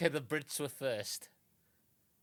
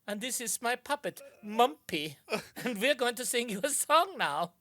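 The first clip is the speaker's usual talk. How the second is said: high, loud mock Dutch showman; hammed-up Dutch TV entertainer; 8 semitones higher, 7 dB louder than usual. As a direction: play a cheesy Dutch variety host. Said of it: "all of Dutch entertainment is that".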